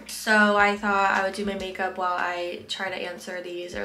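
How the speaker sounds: normal talking voice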